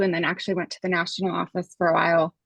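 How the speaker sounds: ordinary talk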